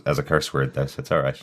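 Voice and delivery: usual speaking voice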